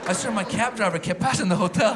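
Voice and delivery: usual speaking voice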